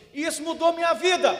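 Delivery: "Isso mudou minha vida" shouted out loud and high; fervent shout. Retold or imitated. Farmer proclaiming to the pastor that the verse changed his life, in a loud shout. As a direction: cry it out like a man testifying before the church.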